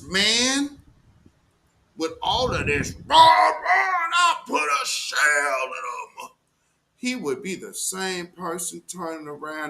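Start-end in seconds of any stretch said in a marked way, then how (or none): 3.1-6.3 high, loud hyped-up rapper yell; a mocking drill-rapper brag, shouted; mock gangster shout. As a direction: ham up a street rapper's boast.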